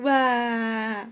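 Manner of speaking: high mock toddler wail; a little one bursting into tears, hammed up; crying wail. Toddler George crying over his broken toy, mock wail. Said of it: "cries George".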